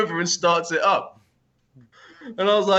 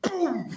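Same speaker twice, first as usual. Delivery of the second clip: high, soft explosive "boom"; imitated boom; 10 semitones higher, 5 dB quieter than usual. Rider mimicking the bike shooting off, imitated boom.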